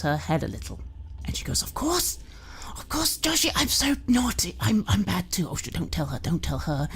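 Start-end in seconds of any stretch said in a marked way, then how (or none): 1.6-6.9 high, breathless manic mother; high giddy mother. Acted. Drunk mother caught out, giddy then panicky. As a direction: giggle it out then hush in panic.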